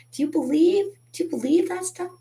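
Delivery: high, rising disbelief; incredulous. Real, not acted. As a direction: toss the question up in amazement. Incredulous at scammers' claims.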